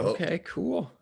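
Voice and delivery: high-pitched retold agreement; mock meek acknowledgement; sheepish acceptance. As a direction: act out meekly going along, voice way up. Retold reaction to judge's rule.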